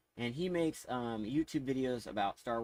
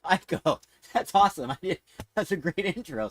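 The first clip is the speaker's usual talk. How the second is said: laughing; 7 semitones higher, 11 dB louder than usual. Real, not acted. Delighted by the AI's intro.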